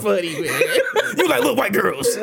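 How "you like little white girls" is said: laughing. Real, not acted. Mocking repeat while laughing.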